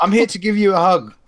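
high-pitched, badly faked South African accent; botched South African accent. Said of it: "give him the real South African".

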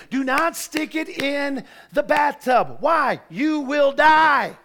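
slow, loud, emphatic warning; stern warning. Real, not acted. Preacher warning the church not to use the iron in the bath, loud and slow. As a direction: drag out you will die for effect.

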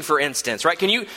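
normal talking voice

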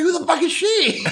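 high squeaky laugh on the question; bursting into laughter mid-question; laughing surprise. Retold or imitated. Retelling the woman popping up, high laughing shock.

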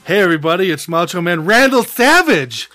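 big hammed-up introduction, punching the name; announcer-style hype. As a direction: announce him like a wrestling star. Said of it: "it'll be like".

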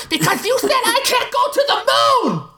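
put-on high shrieking kid voice; screeching little girl. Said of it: "Louise screeched".

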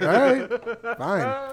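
high, laughing, fed-up giving in. Retold or imitated. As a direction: give in through laughter. Voicing the reluctant giving in.

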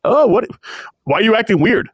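high, baffled dimwit voice; mock someone missing the hint entirely; dim Endeavor. Endeavor misses Hawks's code words, high and baffled. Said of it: "Endeavor's thick in the brain skulls".

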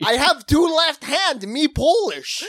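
high, loud mock Polish; joking Polish accent impression; co-host riffing in mock Polish accent. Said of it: "most Polish doctor".

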